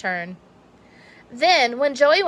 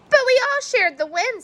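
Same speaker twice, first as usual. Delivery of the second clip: high defensive voice; 14 semitones higher than usual. Acted. Clark defends himself.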